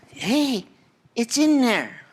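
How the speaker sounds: high, dismissive mama voice; Prego mama. Retold or imitated. Mama brushing off her son at the stove, high mock voice. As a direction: brush him off like a busy mother. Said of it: "The mama looks at him and goes".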